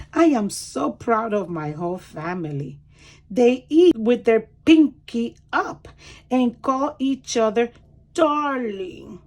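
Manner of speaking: lively storybook read-aloud; reading to children. Acted. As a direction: read it like a picture book for small kids. Reads picture book aloud.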